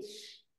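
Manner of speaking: "ch-" as a long, soft hush; soothing hushing sound. Shown as a demonstration. Teacher shows how the hushing sound calms, softly hushed "ch-". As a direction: draw out the soft sh of "ch-". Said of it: "cette chuintante va beaucoup calmer".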